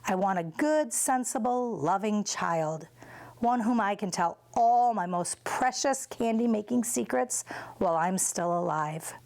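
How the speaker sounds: ordinary delivery, nothing marked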